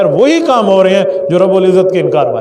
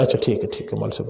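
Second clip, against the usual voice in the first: meek villager agreeing; 10 semitones lower, 13 dB quieter, much faster than usual. Retold or imitated. Convinced villager agrees meekly.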